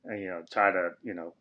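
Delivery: ordinary talk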